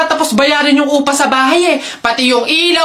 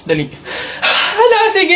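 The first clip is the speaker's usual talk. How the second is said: ordinary talk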